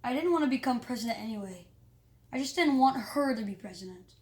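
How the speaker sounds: ordinary delivery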